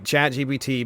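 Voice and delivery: ordinary talk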